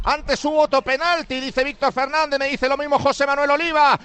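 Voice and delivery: fast, even-pitched radio commentary; live play-by-play. Real, not acted. Commentator updates listeners on play and score, brisk live play-by-play. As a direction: reel off passes, clock and score.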